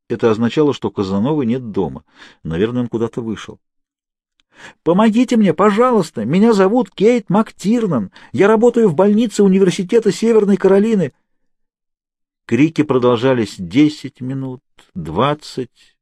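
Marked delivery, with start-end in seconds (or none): 4.8-11.1 high, loud pleading shout; shouting for help. A narrator's reading. Kate shouting through the walls for rescue. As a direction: call out your name and job so someone hears. Trapped woman screaming for help.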